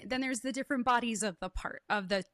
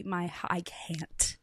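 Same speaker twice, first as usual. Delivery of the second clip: a slow, breaking whisper; choked whisper; much slower than usual. Real, not acted. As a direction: let the words fall away, barely voiced. Overcome while recalling.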